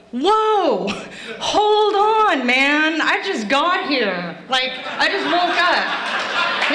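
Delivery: high, drawn-out plea to wait; hammed-up groggy objection, slowing at the end; mock protest. Drunk driver to officer beating her, mock protest.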